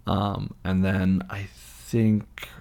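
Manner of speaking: ordinary talk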